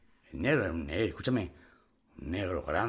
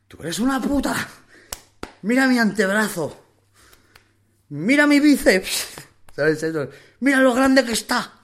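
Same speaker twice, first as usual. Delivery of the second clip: much higher, louder shouted brag; furious boasting shout; 10 semitones higher, 10 dB louder than usual. Retold or imitated. Bullied young inmate turns on the tough guy, yelling and boasting. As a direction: yell it to humiliate him.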